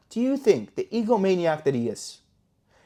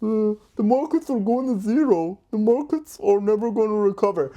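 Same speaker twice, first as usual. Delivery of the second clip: higher-pitched dopey mocking voice; goofy doomsayer; 5 semitones higher than usual. YouTuber imitates viewers who fear a crash, goofy mocking voice. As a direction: put on a silly voice for the naysayers. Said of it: "the impression of you fucking goofies".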